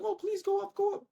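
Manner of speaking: tiny high-pitched mock begging voice; imitated desperate wishing, way up high; hopeful pleading. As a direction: plead in a squeaky falsetto, mocking your own hope. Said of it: "I'm hoping".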